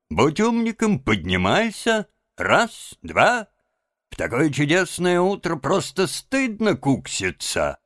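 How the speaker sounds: lively sing-song coaxing, leaping high; jolly the patient out of bed. A narrator's reading. Rat rousing sick Toad, jolly coaxing. Cheering up a bedridden friend.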